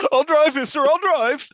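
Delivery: high pleading hostage voice; mock scared hostage. Hostage pleading with the chimp at the wheel, high and panicky.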